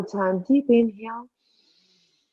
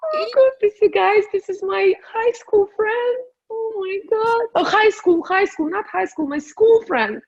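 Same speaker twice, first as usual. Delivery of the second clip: much higher and louder, gasping with delight; overjoyed; 11 semitones higher, 5 dB louder than usual. Real, not acted. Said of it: "I'm gonna cry".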